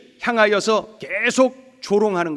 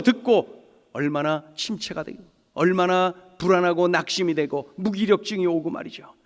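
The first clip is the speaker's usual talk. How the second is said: usual speaking voice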